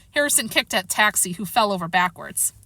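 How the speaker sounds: ordinary delivery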